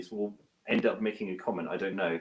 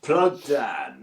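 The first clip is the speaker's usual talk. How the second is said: mock promo voice, a little louder; put-on plug voice; 6 dB louder than usual. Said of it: "do it in that voice".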